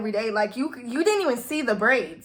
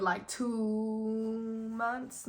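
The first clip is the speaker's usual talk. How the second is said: drawn-out estimate; 8 dB quieter, much slower than usual. Real, not acted. Guessing how long they had been talking, drawn-out and hesitant. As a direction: hold "two" in a long, soft drone.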